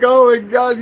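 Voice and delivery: loud incredulous outburst in a retelling; retold exasperation. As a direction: exclaim in acted outrage. Retelling reaction to police.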